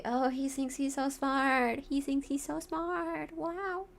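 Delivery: high, slow sing-song sneer; mock his smugness; teasing nasal-free singsong ending in a high wow; sarcastic mockery. Mocking the villain's smugness.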